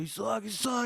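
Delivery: put-on high-pitched kid taunt; jeering boys. Boys pushing each other over a game, high jeering. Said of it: "Just start getting loud".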